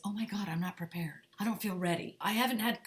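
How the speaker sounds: hushed, anxious whispered worry; panicked actor. Retold or imitated. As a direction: fret under your breath, not ready. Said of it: "the last thing I want you to be doing is".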